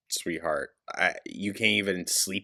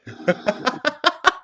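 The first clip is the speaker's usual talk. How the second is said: shrieking disbelieving laugh; high incredulous laugh; 6 dB louder than usual. Real, not acted. Reactor laughing at the vlogger's dip plan, high shriek. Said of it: "Girl. Be so fucking for real".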